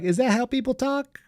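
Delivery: lightly mock a clueless inner monologue; Kamala Harris's inner voice. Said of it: "the disembodied voice in her head go like".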